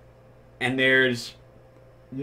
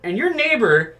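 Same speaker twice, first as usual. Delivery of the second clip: laughing; 8 semitones higher than usual. Retold or imitated. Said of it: "and I'm like".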